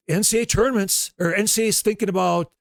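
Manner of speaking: radio announcer quote. Retold or imitated. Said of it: "all of a sudden they say".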